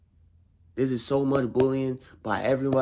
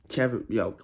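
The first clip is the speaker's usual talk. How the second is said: ordinary talk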